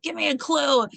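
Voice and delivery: high-pitched frustrated plea; exasperated retold demand; mock exasperation. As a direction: imitate someone at wits' end begging. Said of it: "she's freaking out".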